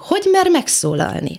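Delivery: mock indignation, pitched well up; indignant disbelief. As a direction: ask it high, as if scandalised. Mimics her indignant schoolgirl reaction to classmates.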